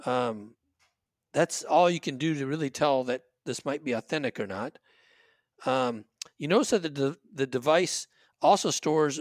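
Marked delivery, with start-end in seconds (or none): none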